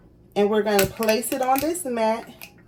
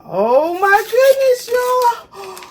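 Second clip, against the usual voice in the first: much higher, louder, delighted squeal; excited squeal; 12 semitones higher, 6 dB louder, slower than usual. Real, not acted. Thrilled to her viewers at the finished tumbler, high excited squeal. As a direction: shriek with joy at the result. Sees the perfect print.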